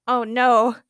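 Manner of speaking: plain reading voice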